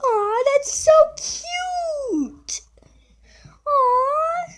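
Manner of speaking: high, drawn-out squeal of adoration; gushing coo. Real, not acted. Viewer finds it so cute, high gushing coo. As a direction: coo over it, high and stretched out. Cooing over something cute on screen.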